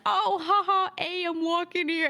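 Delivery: high, mocking Brooklyn accent. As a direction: friends hamming up a Brooklyn accent. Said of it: "they're always like".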